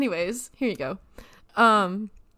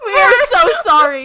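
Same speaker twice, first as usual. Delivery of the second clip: laughing apology; 10 semitones higher, 14 dB louder than usual. Real, not acted. Callee finds out it's a prank.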